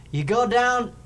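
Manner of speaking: loud, high-pitched Irish bartender rant; big, high Irish brogue at full volume; strong Irish accent. Retold or imitated. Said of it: "in this strong Irish accent".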